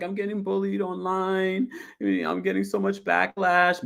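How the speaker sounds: high whiny mock sob; mock crying Julia. Mocking a crying castmate, whiny high voice. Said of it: "she's just like".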